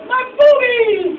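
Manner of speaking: very high, loud, drawn-out cry; hammed-up high-pitched wail; mock female squeal. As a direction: squeal it like a cartoon woman. Imitating woman in hentai story.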